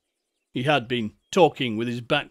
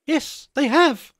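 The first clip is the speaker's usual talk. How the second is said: very high, loud schoolboy voice; schoolboy character; 13 semitones higher, 5 dB louder than usual. Acted. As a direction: pipe up like an earnest little boy. Wilfrid's squeaky interjection.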